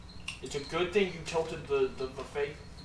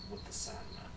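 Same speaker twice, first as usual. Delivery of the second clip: whispered menace; 6 semitones higher, 10 dB quieter than usual. Acted. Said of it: "looks at you and goes".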